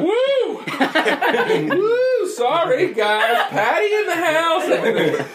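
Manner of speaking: high, laughing, whooping entrance; party girl entrance. Retold or imitated. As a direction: squeal it like a party girl arriving. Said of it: "she walks out of the bathroom like".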